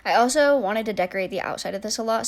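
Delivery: ordinary talk, nothing marked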